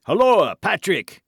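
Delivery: high, loud summoning shout; calling out. A narrator's reading. Buckingham summons Patrick for aid, calling out. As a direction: shout for someone outside the room. Duke calls his servant.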